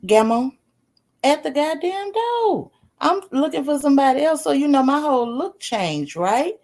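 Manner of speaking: normal talking voice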